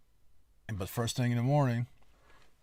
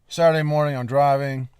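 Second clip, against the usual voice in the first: excited; 6 semitones higher, 13 dB louder than usual. Retold or imitated. Retelling the drive, voice jumps up.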